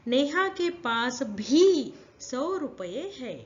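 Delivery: slow, with भी drawn out and stressed; slow classroom demo stressing भी. Shown as a demonstration. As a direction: slowly weigh down on भी. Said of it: "फिर से सुनो".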